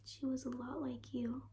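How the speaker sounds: hushed whisper; quiet, tender whisper; softly. Acted. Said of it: "then said softly".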